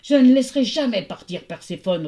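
angry, forceful Hades; roaring. A narrator's reading. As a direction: roar a refusal like a possessive god. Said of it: "rugit Hadès".

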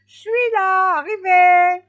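high, full-voiced shout; triumphant call. A narrator's reading. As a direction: call out loudly across a field. Hedgehog rehearses wife's triumphant call. (cri triomphant, fort et aigu)

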